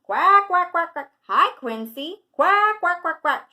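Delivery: loud, high cartoon quacking; shouting duck. A narrator's reading. Dottie Duck greeting Quincy the quail, shouted quacks. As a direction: blast out quacks as an excitable duck. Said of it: "shouts Dottie".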